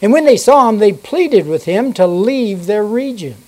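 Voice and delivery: measured King James reading voice; steady pulpit reading; scripture reading. Acted. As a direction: read the Bible passage to the congregation. Reads scripture aloud in church.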